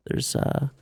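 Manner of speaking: hushed ASMR-style call-out, partly whispered. Retold or imitated. Gamer jokingly whispering call-outs to his lobby, ASMR style. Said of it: "start doing call-outs in A S M R".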